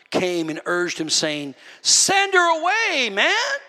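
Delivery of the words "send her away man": high, drawn-out complaint; mock annoyed plea. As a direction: act out fed-up disciples, pitched way up. Acts out annoyed disciples.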